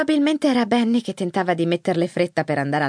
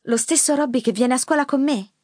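higher, incredulous question; surprised granddaughter; 7 semitones higher than usual. A narrator's reading. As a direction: ask in disbelief. (nipote sorpresa, tono acuto)

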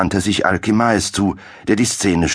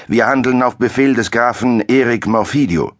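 stern captain; 6 semitones higher than usual. A narrator's reading. Captain tells the alchemist he acts on the count's order, sternly. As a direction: state the order with authority.

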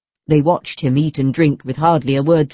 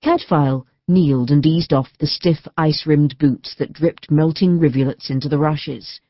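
ordinary delivery, nothing marked

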